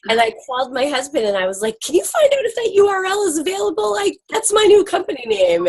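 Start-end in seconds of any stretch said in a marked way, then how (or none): 1.9-5.7 high, fast excited rush. Retold or imitated. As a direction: blurt it out in thrilled haste. Retells calling husband excitedly.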